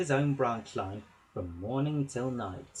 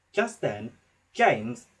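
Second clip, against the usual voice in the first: usual speaking voice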